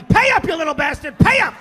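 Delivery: high, very loud furious shouting; vigorous, angry. Acted. Dad demanding money he is owed, furious shouting. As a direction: yell like you're shaking down a debtor. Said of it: "it was so vigorous and angry".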